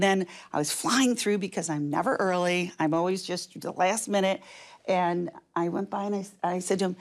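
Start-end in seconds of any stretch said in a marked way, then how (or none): none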